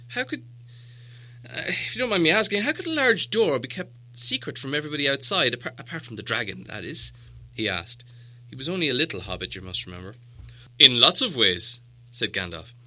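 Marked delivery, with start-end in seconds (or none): 0.1-7.1 much higher, fast, hesitant hobbit voice; timid hobbit. Acted. Bilbo asks eagerly. 10.8-11.8 the wizard reasoning, a little louder; patient wizard. Acted. Gandalf answers Bilbo.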